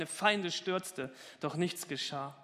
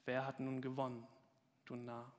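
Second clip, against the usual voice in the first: trembling voice; 2 semitones lower, 7 dB quieter than usual. Acted. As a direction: lean in and breathe the taunt. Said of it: "sagte mit zitternder Stimme".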